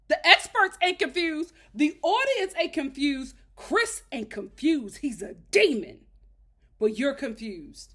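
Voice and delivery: loud, emphatic indignation; exasperated rant. Real, not acted. Host ranting that only Paige is confused, loud exasperation.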